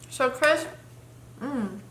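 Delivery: stopping to chew mid-sentence; mouth full. Real, not acted. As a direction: cut off by a mouthful. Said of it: "Let me swallow first".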